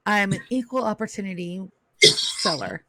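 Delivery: usual speaking voice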